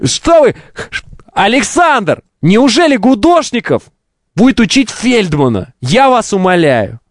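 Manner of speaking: lightly put-on Odessa Russian accent; a hint of an Odessa Russian accent. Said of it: "Александр Фельдман".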